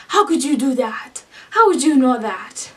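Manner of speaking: indignant snark with an attempted French accent; snarky Scarlet. Audiobook Scarlet challenging Wolf, playfully imitated snark. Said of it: "she's being all snarky".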